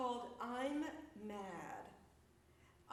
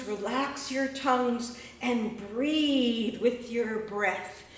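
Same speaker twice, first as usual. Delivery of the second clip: slow, louder rhythmic recitation; poem performance; 13 dB louder than usual. Acted. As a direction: chant it like spoken word. Performs his breath sound poem.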